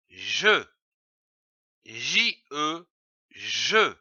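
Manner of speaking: slow teacher syllable drill, letters spelled between; syllable sounded out. Shown as a demonstration. Teacher sounding out ja for a child. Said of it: "Je lis une syllabe".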